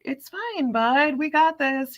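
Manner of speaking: calming a bleeding little brother; reassuring. Retold or imitated. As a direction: soothe a scared little kid. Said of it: "I'm just like".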